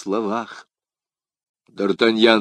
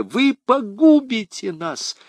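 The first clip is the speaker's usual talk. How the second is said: much higher, slow desperate plea; crying out; 12 semitones higher, 3 dB louder, slower than usual. A narrator's reading. Madame Bonacieux pleads with d'Artagnan, desperate. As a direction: beg him to stop.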